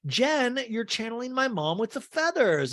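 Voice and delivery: high imitated gushing voice; Chloe. Chloe greets Jen about her feathers, high mock gushing. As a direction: pitch the compliment way up. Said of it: "and Chloe's like".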